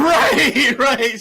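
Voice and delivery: laughing through the words. Real, not acted. Laughing agreement.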